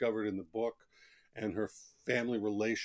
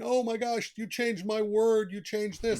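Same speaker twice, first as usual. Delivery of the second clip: mock fussy author; 11 semitones higher, 4 dB louder than usual. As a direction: play the precious author clutching every word. Author fussing over changed words.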